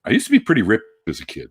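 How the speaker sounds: ordinary talk, nothing marked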